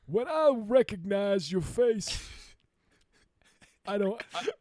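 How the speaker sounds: put-on old man voice. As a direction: keep the doddering old-timer going. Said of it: "this girl I knew back in the fifties".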